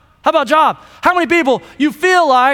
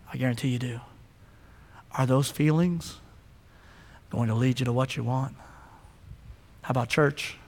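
much lower and softer, close; quiet hush; 8 semitones lower, 6 dB quieter than usual. Acted. Pastor presses the congregation gently about their wants, hushed. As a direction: sink to a confiding murmur.

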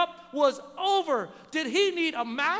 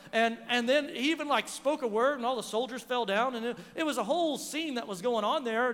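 normal talking voice

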